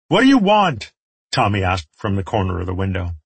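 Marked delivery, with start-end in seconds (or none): 0.1-0.9 high, loud shouted question; calling out. A narrator's reading. Tommy questions the enemy from the window, calling out. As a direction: shout it down to someone below.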